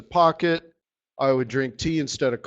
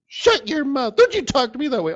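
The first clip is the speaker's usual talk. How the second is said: much higher, indignant wife snapping back; bossy wife; 9 semitones higher than usual. Pretend. Wife scolds husband for taking her spot, high indignant snap. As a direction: play the offended wife putting him in his place. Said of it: "And she's".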